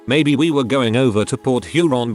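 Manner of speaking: plain reading voice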